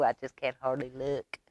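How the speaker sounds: usual speaking voice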